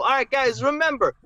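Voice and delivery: put-on lightly done movie New York accent; Steve Martin New York accent. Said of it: "Steve Martin's awesome fake New York accent".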